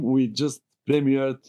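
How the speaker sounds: ordinary delivery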